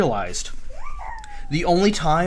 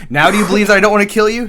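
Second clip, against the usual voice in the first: loud, fast, high-pitched challenge; retold demand; 6 semitones higher, 7 dB louder, much faster than usual. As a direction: fire the question off forcefully, imitating. Retold uncle driving his point home.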